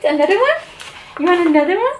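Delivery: very high sing-song voice for the dog; high puppy-talk coo. Real, not acted. Offering dog treats.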